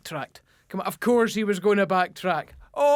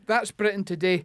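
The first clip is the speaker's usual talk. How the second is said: ordinary talk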